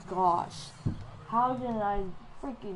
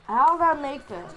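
loud, raised-pitch astonishment; amazed outcry; 4 semitones higher, 10 dB louder than usual. Real, not acted. Player amazed at his own basket, loud disbelief. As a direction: cry out, stunned by your own luck.

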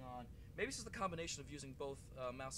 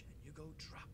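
unvoiced muttered whisper; 6 dB quieter than usual. Real, not acted. Gamer muttering at the enemy, whispered. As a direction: breathe it under your breath.

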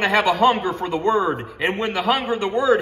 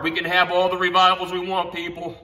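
usual speaking voice

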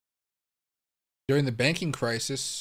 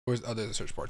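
usual speaking voice

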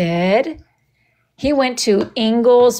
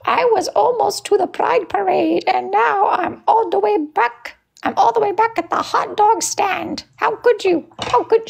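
very high put-on cartoon voice, wailing; squeaky character voice; 12 semitones higher than usual. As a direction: whine it like a little cartoon character. Character voice lamenting going back.

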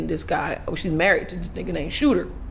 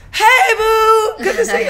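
loud, high-pitched mock-friendly hello; gushing fake greeting; 13 semitones higher, 7 dB louder, slower than usual. Co-star greets her for the cameras, gushing. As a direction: squeal it like a put-on TV hello.

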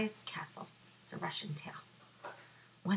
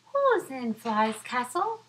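mouse calling out; 5 semitones higher, 7 dB louder than usual. Acted. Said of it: "Mouse calls out".

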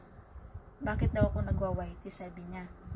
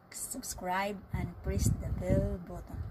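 ordinary delivery

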